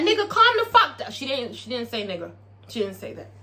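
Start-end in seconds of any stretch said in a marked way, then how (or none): 0.0-1.1 high, loud stern telling-off; telling him off. Retold or imitated. Gidget standing up to Big Teak. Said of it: "Gidget stands up to Big T".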